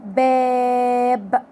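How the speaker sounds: باب with the alif held very long; very slow drawn-out باب; stretched word. Shown as a demonstration. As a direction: pull out the alif of باب slowly. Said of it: "يقولك كلمة على سبيل المثال".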